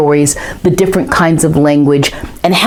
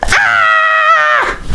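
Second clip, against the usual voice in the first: sudden high breathy cry; startled cry. Acted. Boy in bed cries out in a high startled gasp. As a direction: jump at the sound.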